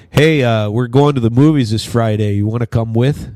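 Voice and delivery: a classmate asking you along, Minnesota style; Minnesota friend. Retold or imitated. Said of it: "somebody'd go".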